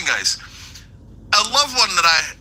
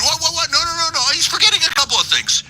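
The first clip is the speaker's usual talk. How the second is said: mock flustered aides; 9 semitones higher than usual. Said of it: "They're going".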